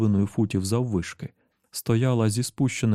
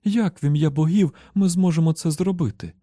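high, louder wife's voice; raised pitch, urgent disbelief; desperate question; 7 semitones higher, 4 dB louder than usual. A narrator's reading. Wife asking husband how they could, desperate.

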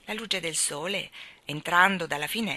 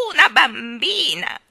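loud, high, drawn-out exclamation; excited cry; 6 semitones higher, 12 dB louder, slower than usual. A narrator's reading. Starling announces the newborn girl, excited cry. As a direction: cry it out slowly and loudly in delight. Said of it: "egli gridò".

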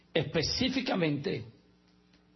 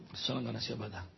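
much lower and softer, a sly aside to himself; muttered under breath; 10 semitones lower, 9 dB quieter, much faster than usual. Acted. Preacher repeats his joke to the congregation as a soft low mutter. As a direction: drop it to a low knowing mutter.

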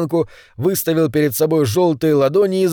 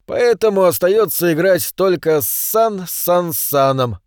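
higher-pitched, drawn-out quirky character voice; eccentric chess lover, chatty; 4 semitones higher than usual. Acted. Orange man quizzes strangers about chess, eccentric chatter. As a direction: chatter on like an odd old chess fanatic.

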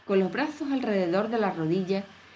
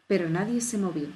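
ordinary delivery

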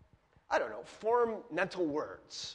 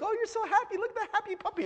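put-on much higher baby talk to a dog; cooing at a puppy; 13 semitones higher, faster than usual. Owner cooing at a hot panting dog, much higher baby talk. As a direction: gush over the puppy like a doting owner.

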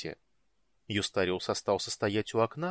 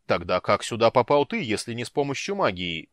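loud indignant demand; indignant shout; 7 dB louder than usual. A narrator's reading. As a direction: shout it in outrage. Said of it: "негодующе выкрикнул Парсалиан".

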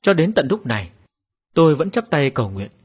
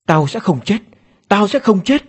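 desperate defiance rising in pitch and force; defiant scream; 6 semitones higher, 4 dB louder than usual. A narrator's reading. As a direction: cry out, building from raised voice to high, loud shout. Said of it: "Tôi gào lên". (gào lên quyết liệt, càng lúc càng cao)

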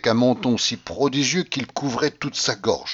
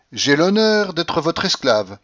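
higher and slow, every word detached; loud, word by word; 6 semitones higher, slower than usual. A narrator's reading. Mock-gallant greeting to a baroness, loud and slowly detached. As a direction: proclaim it with exaggerated clarity. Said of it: "très haut et en détachant chaque mot".

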